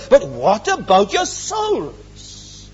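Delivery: drawn-out, weighty refrain; slow pleading question to the congregation; slow solemn question. Acted. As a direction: lean on each word of the warning.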